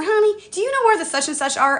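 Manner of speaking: high-pitched imitation of a senior shopper; senior citizen. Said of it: "it was Senior Citizen Day".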